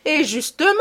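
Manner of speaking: put-on young sleuth cutting in with conviction; convinced exclamation. A narrator's reading. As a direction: cry out, sure of yourself. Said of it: "s'écria Beautrelet d'un ton de conviction".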